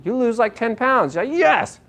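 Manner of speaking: high, excited mock cheer over "ten pounds". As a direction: play the thrilled dieter, voice jumping up. Mimics thrilled dieter's quick loss.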